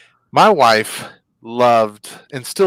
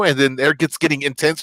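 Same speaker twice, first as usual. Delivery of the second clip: ordinary talk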